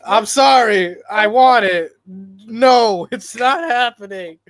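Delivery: loud, high cry of disappointment; pained outburst. Real, not acted. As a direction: belt out the hurt of a let-down fan. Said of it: "you can hear the pain in my voice".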